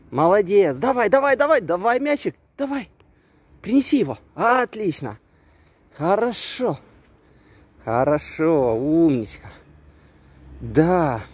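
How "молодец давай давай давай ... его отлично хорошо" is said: high, bright praise for the dog; talking to a dog. Real, not acted. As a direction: cheer the dog on, pitch up. Praising dog during fetch.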